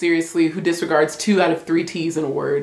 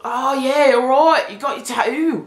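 put-on higher-pitched British accent, dropped t's; mock British accent; 5 semitones higher than usual. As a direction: imitate a Brit chatting about a ta'oo. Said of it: "Brits say Tattoo".

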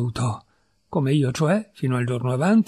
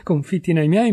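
ordinary delivery, nothing marked

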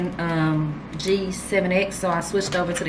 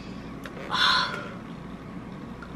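long breathy sigh of delight; blissful sigh; 10 dB quieter than usual. Real, not acted. Smelling her favorite scent, she lets out a blissful sigh. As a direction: sigh like it's almost too good. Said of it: "About to cry".

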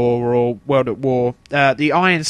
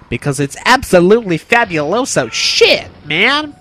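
hammy exclamation; 5 semitones higher, 7 dB louder than usual. Real, not acted. Emphatic put-on exclamation.

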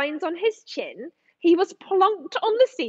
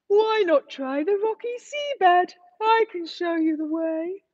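sleepy shrimp. Acted. Shrimp advising the lost dolphin, sleepy. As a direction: voice the drowsy shrimp pointing the way.

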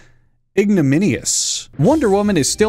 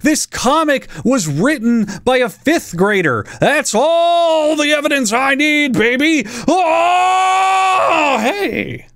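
higher, slow, building to a shout; mock outraged yell; 10 semitones higher, slower than usual. Real, not acted. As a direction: snap from joke to screaming outrage. Exploding into mock rage.

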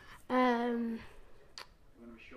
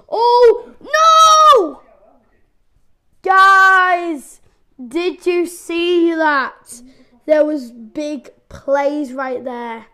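much louder, much higher drawn-out yell; anguished shout; 4 semitones higher, 18 dB louder, much slower than usual. Real, not acted. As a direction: howl the loss at the viewers. Eaten after a big play.

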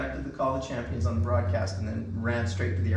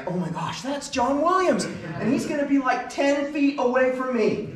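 starstruck excitement; 11 semitones higher, 5 dB louder than usual. Retold or imitated. Retelling seeing [name] walk on stage, high starstruck gush. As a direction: squeal like a fan meeting their idol.

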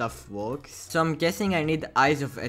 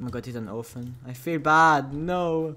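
ordinary talk

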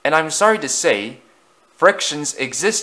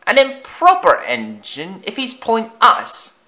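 higher, louder, snooty coach voice; sniffy old coach; 10 semitones higher, 4 dB louder than usual. Acted. Second coach sneers at Afton, high and sniffy. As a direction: look down your nose at him. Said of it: "said another".

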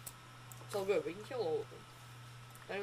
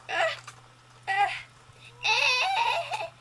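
high yelps while falling; screaming while falling; 3 dB louder, much slower than usual. Real, not acted. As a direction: scream as you drop. Falling, chased by pigmen.

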